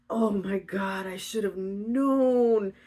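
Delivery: drawn-out mock lament; slow, hammed-up regret; mock dismay. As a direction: stretch it out like a wounded complaint. Voices Orpheus's dismay after the rescue.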